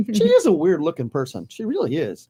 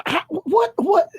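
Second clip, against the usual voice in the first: high confused what; baffled, rising disbelief; 10 semitones higher, slower than usual. Real, not acted. Baffled reaction.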